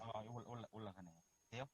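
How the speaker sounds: barely audible phone line; faint connection. Real, not acted. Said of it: "아직도 너무 적은데". (멀리서 작게 들리는 통화 소리)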